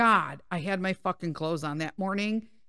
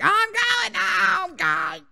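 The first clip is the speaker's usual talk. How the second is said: high, stretched-out holler; re-enacted exasperated yell at the dog; fed-up shout; 10 semitones higher, 5 dB louder, slower than usual. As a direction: yell that you're giving up and leaving. Acting out giving up on dog.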